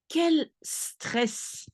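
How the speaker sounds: Quel stress said the French way, kel stress. Shown as a demonstration. Teacher modelling Quel stress in French. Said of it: "So now you say".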